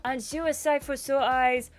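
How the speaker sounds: lightly put-on French charmer; French accent. Lyle flirting with Iris on arrival, in a French accent. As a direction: woo her with a French lilt. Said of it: "Is he French? Can we get away with that?".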